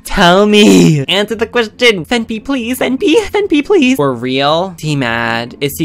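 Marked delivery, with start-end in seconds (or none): 0.0-4.0 higher and higher, louder begging; desperate pleading. Pretend. Girlfriend character begging Fenpy to answer, rising pleading. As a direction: beg him to answer.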